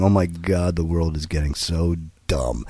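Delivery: low, soft, weary aside; hushed, acted disbelief; retold dismay. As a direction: mutter it low in mock despair. Retold dismay at talk radio.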